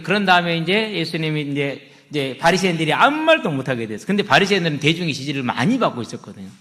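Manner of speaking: usual speaking voice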